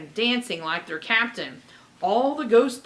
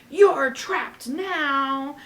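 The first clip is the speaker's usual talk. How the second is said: slow, loud, higher pirate roar; 5 semitones higher, 5 dB louder, much slower than usual. Acted. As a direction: drag out the threat and roar it.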